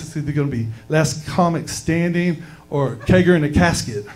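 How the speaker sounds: ordinary talk, nothing marked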